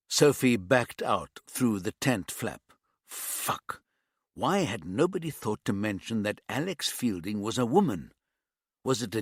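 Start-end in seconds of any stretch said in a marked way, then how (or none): 3.1-3.8 breathless whispered swear to herself; whispered curse. A narrator's reading. Embarrassed detective swears to herself outside, whispered curse. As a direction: hiss it under your breath.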